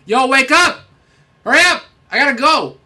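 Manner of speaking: high, loud, urgent yelling; shouted wake-up call. Pretend. Some dude on the app waking a stranger, shouting. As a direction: yell it down the phone in a rush.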